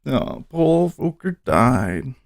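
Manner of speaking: quiet sombre repeat of the headline; subdued sadness. Real, not acted. Streamer rereads Volcker death to viewers, hushed and sad. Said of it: "Oh, that's so sad".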